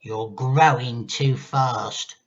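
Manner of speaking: put-on slow, loud, spiteful old woman; grandma scolds George with slow spite; slow menacing grandma. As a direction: lean in and sneer each word. Grandma voiced in story.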